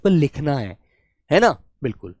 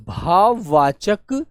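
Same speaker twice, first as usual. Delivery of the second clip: word dictated slowly; much slower than usual. Shown as a demonstration. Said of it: "ऐसे भाववाचक शब्द".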